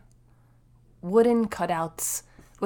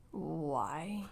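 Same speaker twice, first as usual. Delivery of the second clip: whisper; 3 semitones lower, 13 dB quieter than usual. Real, not acted. Whispered baffled aside.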